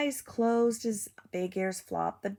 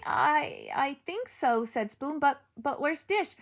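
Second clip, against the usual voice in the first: high and stammering; dazed, unsure; shaky spoon voice; 6 semitones higher than usual. Acted. Dazed Spoon after fall.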